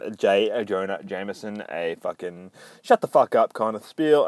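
ordinary delivery

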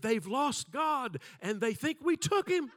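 put-on a scared boy blurting out the awful news; terrified kid. Terrified boy fleeing pastor's office.

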